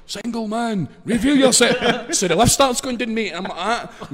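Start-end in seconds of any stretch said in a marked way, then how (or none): 0.1-2.1 high-pitched, drawn-out TV host call; slow, showy presenter's introduction; Paddy McGuinness. Retold or imitated. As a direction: call it out like a dating show host. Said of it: "you hear Paddy McGuinness going down here".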